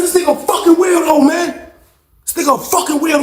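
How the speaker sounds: high-pitched mock outrage; worked-up, high indignant cry; retold exasperated protest. Retold protest about cellmate.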